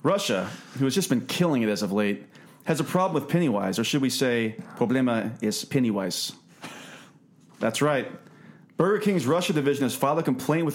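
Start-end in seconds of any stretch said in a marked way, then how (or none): none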